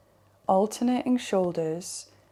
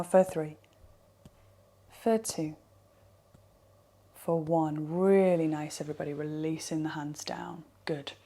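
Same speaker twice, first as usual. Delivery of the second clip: soft, lower winding-down voice; guided meditation; 4 semitones lower, 4 dB quieter than usual. Acted. Teacher counting listeners out of the tapping, soft meditation voice. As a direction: ease them out gently, letting the voice sink.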